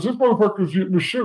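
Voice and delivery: hammed-up disbelief, rushed out; [name] amazed. [name] marvelling at sandwich.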